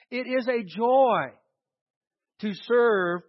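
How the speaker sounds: usual speaking voice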